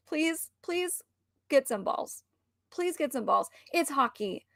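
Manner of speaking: soft, begging aside; hushed exasperated plea; quietly begging him to toughen up. Real, not acted. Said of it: "pleading to the husband".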